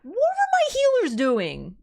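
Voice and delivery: high, slow outraged whine; exasperated outcry. Real, not acted. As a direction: demand it of your useless healers. Dies in game, outraged.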